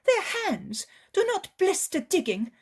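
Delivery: an upset woman, high and loud, almost crying; bitter lament. Acted. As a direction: pour out a poor mother's grievance.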